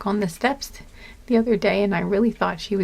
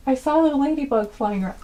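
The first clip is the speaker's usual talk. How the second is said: normal talking voice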